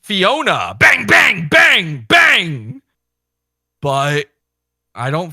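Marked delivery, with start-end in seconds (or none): 0.8-2.8 loud, high shouted bang bang; mock gunshots. Joking about Fiona, loud mock gunshot shouts. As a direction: yell the gun noises.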